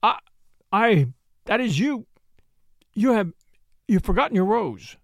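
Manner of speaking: halting, feeble stammer; feebly stumbling over the words. A narrator's reading. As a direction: falter and trail into a weak excuse. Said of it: "he added, feebly".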